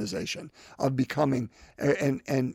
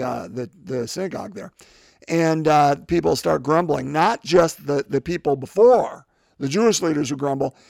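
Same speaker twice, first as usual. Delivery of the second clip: ordinary delivery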